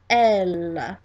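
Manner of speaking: "elle" said clearly in French, drawn out; model pronunciation. Shown as a demonstration. Teacher has class repeat the word for she, model pronunciation. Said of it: "pronounce after me".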